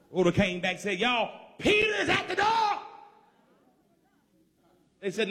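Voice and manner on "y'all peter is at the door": high-pitched, breaking-news cry; excited announcement. Acted. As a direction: call the amazing news out to the house. Acts Rhoda announcing Peter's arrival.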